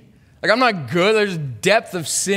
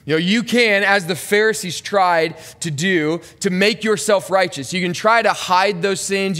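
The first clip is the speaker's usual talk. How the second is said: impassioned preaching; 3 semitones higher than usual. Acted. Preaching intensifies at the Pharisees.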